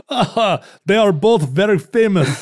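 high, loud alien voice from the future; futureling alien voice. Acted. Host mocks future fans chuckling over them in a high alien voice. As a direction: play a tentacled future creature, pleased and grand. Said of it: "Futurelings right now are chuckling into their tentacles saying".

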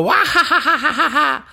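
high, loud evil cackle; witch cackle. Acted. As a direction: cackle like an old hag. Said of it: "Do your witch voice".

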